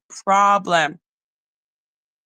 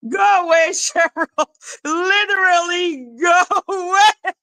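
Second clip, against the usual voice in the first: high squeal on the edge of laughter; laughing protest; 9 semitones higher than usual. Real, not acted. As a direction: shoo them away through helpless giggles. Shooing Cheryl after laughing fit.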